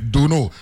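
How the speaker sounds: "Duno", first syllable punched; stressed first syllable. Shown as a demonstration. Insisting on the local way to say "Duno". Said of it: "emphasis on the first syllables".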